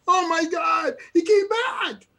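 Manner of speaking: high, loud "oh my God" burst; excited disbelief. Retold or imitated. Telling a friend how he reacted, mock shocked shout. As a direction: shout it like you can't believe he's back. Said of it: "I'm like".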